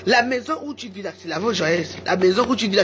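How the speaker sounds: ordinary talk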